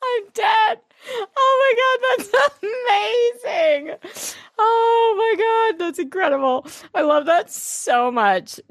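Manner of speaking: high, laughing cries of delight; laughing shriek. Real, not acted. Reacting to her friend calling him Jamie, high laughing squeals.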